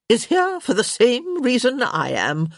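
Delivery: high-pitched, emphatic countess; crotchety countess. A narrator's reading. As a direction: declare it as a blunt elderly countess. Lady Danbury speaks in the story.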